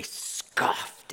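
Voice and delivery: SCOFFED with a long hissed s; sound-effect word. Shown as a demonstration. Preacher shows onomatopoeia, SCOFFED said slowly like a scoff. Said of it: "words that sounds like what it is".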